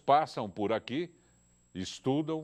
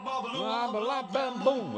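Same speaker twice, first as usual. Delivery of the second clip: mock babble; 12 semitones higher, much slower than usual. Mimics chaotic phone chatter.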